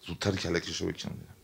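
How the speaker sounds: very soft, imitated aside to someone close by; retold confidential aside. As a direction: repeat his words low-voiced, as if leaning in. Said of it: "سرش آورده بود جلو به طرف". (نقلِ حرفِ درگوشی با صدای خیلی آهسته)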